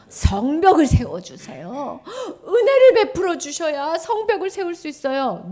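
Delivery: loud, tearful plea; crying plea. Acted. Teacher voices Nehemiah begging God through tears.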